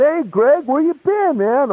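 high, eager where-were-you call; imitated buddy greeting. Old buddies greeting him after a week, high eager greeting. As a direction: imitate pals hailing a long-missing friend.

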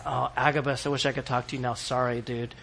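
soft, rueful apology to an absent friend; Paul softly wishing he'd listened to Agabus; regretful murmur. Retold or imitated. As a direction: mutter it like you've let him down.

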